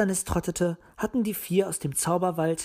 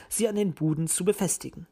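usual speaking voice

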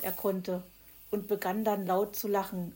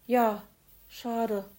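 sighed; 4 semitones higher, 7 dB louder than usual. Acted. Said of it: "seufzte Hoppel".

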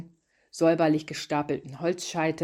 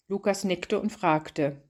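usual speaking voice

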